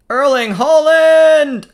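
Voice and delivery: high, loud, drawn-out cry of the player's name; real match-excitement yell; excited commentator shout. Real, not acted. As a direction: shout it out, stretching it as the chance comes. Shouts as Haaland gets the chance.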